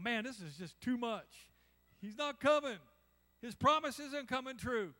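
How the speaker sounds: rising, loud anguished complaint that he's not coming; despairing cry. Retold or imitated. Believer at the end of his rope, loud despair. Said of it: "and you're just going".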